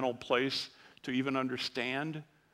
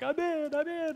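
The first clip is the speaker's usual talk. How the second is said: much higher, squealing joy; excited daughter. Retold or imitated. Daughter jumping down the sidewalk, higher excited squeal. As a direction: bounce it like a thrilled girl.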